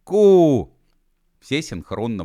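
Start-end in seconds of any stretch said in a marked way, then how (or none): 0.0-0.8 high, loud, drawn-out call; commanding "koo". A narrator's reading. Etsilop meets the group in a corridor, commanding call. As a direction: call out one loud syllable.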